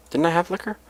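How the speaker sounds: ordinary delivery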